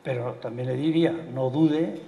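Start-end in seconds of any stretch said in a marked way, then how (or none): none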